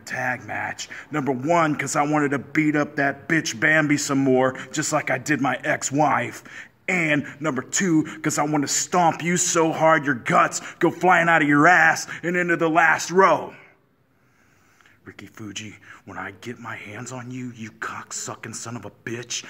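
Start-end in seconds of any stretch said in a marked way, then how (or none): 1.1-13.6 furious shouting. Acted. Heel promo threats building up. 15.0-19.5 soft, low, menacing threat; quiet menace. Acted. Sudden drop to menacing threat.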